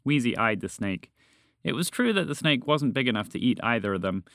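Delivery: ordinary delivery, nothing marked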